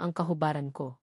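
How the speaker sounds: plain reading voice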